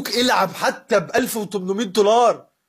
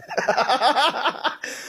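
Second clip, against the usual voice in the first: laugh; 4 semitones higher than usual. Real, not acted. Laugh after mock voice.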